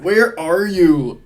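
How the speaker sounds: loud, high mock Ohio accent, very drawn out; Alonzo's thick Ohio accent. Commentator gleefully repeating the suspect's line, thick Ohio accent. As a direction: savour each word in a thick Ohio accent. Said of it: "Some sort of Ohio accent".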